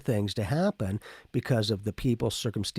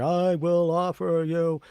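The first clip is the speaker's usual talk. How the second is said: slow, higher, louder hypnotized monotone; mock trance voice; 6 semitones higher, 5 dB louder, slower than usual. Mocking a person offering help in a trance. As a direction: drone it like someone under a spell. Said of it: "didn't go into a trance going".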